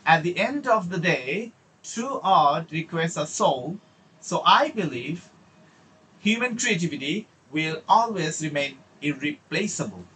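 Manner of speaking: slow, deliberate closing statement; measured delivery with a pause after each phrase; model conclusion. Shown as a demonstration. Teacher shows students how an answer should conclude, slow and deliberate. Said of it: "এবার কনক্লুশনে খেয়াল করেন".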